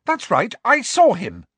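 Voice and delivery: loud and fierce, standing firm. A narrator's reading. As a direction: throw it back at him fiercely. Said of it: "she said fiercely".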